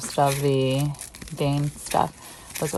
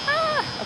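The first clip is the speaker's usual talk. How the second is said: excited squeal. Real, not acted. Squeal on surprise night.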